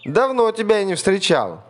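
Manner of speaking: very high, loud hearty hello; cheery mouse greeting. A narrator's reading. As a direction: hail granny owl with glee. Mouse hails owl.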